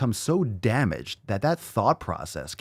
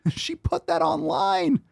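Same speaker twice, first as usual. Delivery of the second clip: much higher and slow, stunned; incredulous; slower than usual. Real, not acted. Vlogger stunned that she posted it, high and slow. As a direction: stretch it out in disbelief. Disbelief at the post.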